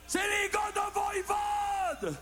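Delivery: crowd-pleasing announcement yelled out; triumphant shout. Acted. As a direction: call the band's name out like a big reveal. Proud punchline called to the crowd.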